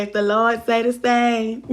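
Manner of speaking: put-on a light touch of an old Southern grandmother; mildly imitated old granny saying; quoting granny. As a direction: slip briefly into granny's voice. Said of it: "Look like my granny used to say".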